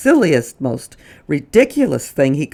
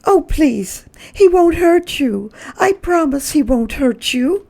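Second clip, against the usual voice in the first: reassuring; 14 semitones higher, 4 dB louder than usual. Acted. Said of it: "Missus Prim tried to reassure her".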